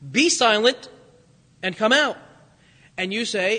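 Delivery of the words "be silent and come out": firm, loud command; stern rebuke. Shown as a demonstration. Jesus ordering the unclean spirit out, stern and loud. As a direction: cast the spirit out firmly. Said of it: "Jesus only gives two commands here".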